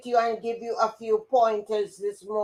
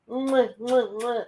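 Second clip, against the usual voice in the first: between kisses. Real, not acted. Blowing kisses to old friend.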